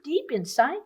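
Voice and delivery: plain reading voice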